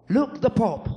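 put-on a small boy pointing it out again; little son insisting. Son repeating it to his father, lightly voiced toddler.

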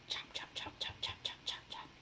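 whispered rhythmic chopping sounds; chopping noise. Retold or imitated. Imitating Jesus chopping with a machete, whispered chops. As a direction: imitate a blade chopping, voiceless and even.

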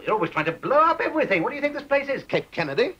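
incredulous, high-pitched rebuke; exasperated scolding. Acted. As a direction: demand it in rising exasperation. Boss continues scolding employee.

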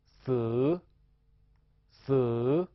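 死 held long, dipping then rising; drawn-out rising syllable. Shown as a demonstration. Teacher models a Thai syllable, 死 long and rising. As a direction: say 死 slowly as one long rising vowel. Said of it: "这个我们读作".

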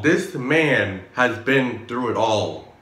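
ordinary talk

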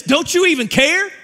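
ordinary delivery

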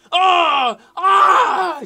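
high, full-volume "oh" and "ah" screams; loud scream. Real, not acted. Gamer watching the match highlight, screaming. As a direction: yell out at a near miss.

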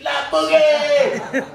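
loud, high, drawn-out holler; big stretched-out shout of greeting; delighted yard call. Retold or imitated. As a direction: call out a friend's nickname across the yard. Calling out across the yard.

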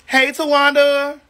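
drawn-out sing-out greeting; playful calling out. Pretend. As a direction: call the name out slowly, stretching the end. Calling a nickname to viewers.

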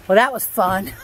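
normal talking voice

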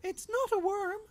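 tiny squeaky badger explaining; little badger voice. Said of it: "Stuart the Badger explained".

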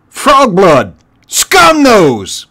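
very loud, high-pitched yell; commander's shouted summons; calling out. Acted. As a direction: bellow the names across the ranks. Warlord calls out henchmen by name.

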